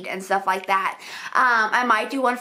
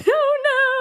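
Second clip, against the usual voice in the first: startled; 14 semitones higher, 4 dB louder than usual. Real, not acted. Sudden mishap.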